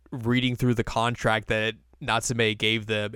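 ordinary delivery

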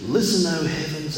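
normal talking voice

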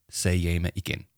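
usual speaking voice

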